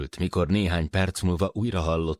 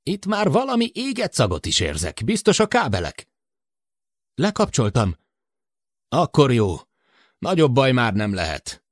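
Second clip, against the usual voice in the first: put-on higher, worried older man over the intercom; Matt's voice; 8 semitones higher, 3 dB louder than usual. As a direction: voice Matt, anxious about the short circuit. Matt's voice continues. (Matt aggódó hangja)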